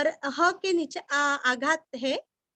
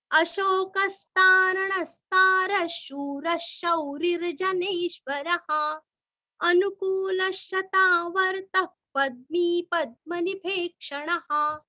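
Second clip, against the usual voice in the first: steady, even chant of the shloka; measured devotional chanting; Sanskrit recitation; 4 semitones higher, 3 dB louder, much slower than usual. Acted. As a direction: intone each line evenly for pupils to follow.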